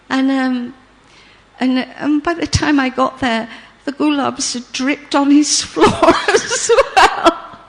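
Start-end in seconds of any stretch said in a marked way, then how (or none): 3.8-7.6 words breaking into giggles; laughing while telling. Real, not acted. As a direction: giggle through the punchline. Laugh heard on line.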